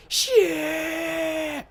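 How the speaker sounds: long, very high loud shriek; screamed curse. Retold or imitated. Retelling getting shot off a cliff in game, high screamed curse. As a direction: shriek it as if falling, drawn out.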